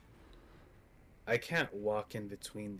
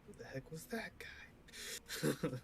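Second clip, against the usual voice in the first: whisper; 6 semitones higher, 7 dB quieter, slower than usual. Real, not acted. Player aside.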